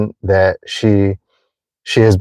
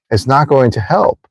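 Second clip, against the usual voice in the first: ordinary delivery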